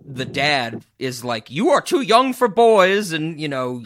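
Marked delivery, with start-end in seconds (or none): 1.5-3.1 put-on half-committed Indian accent, louder; weak, hammy Indian accent impression; terrible Indian accent. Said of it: "That was a terrible Indian accent that I just attempted to do".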